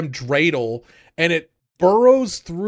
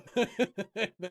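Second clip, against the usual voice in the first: soft laugh; quiet laugh; 8 semitones higher, 9 dB quieter than usual. Real, not acted. Laugh after shoe sniff.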